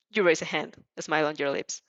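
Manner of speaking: usual speaking voice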